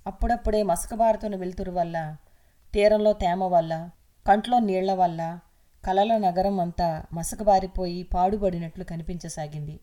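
plain reading voice

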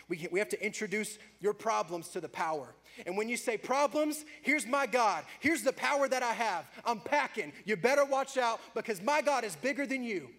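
loud, high defiant taunt at problems; fiery preaching. Acted. Preacher defying problems with God's power, fired up. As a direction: preach it like a battle cry.

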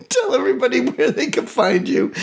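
winded from a laughing fit; after laughing hard. Real, not acted. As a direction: still catching breath from laughing. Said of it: "Oh, my stomach hurts so bad".

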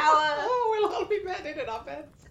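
high hammed-up old dear; old lady voice. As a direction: giggle through a doddery granny line. Old couple role play.

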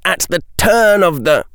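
high, declaiming figure; strong voice. A narrator's reading. Robed figure reciting to Daphne, strong voice. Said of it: "said in a strong voice".

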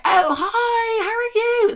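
very high, squeaky hello; fake cheery greeting. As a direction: squeal a falsely bright phone greeting. Fake cheery reply to mum.

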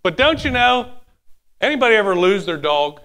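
ordinary talk, nothing marked

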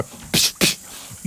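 unvoiced puffs; whispered kick noises; quiet kick sound effects; soft kick sound.

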